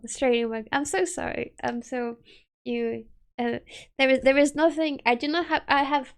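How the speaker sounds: ordinary delivery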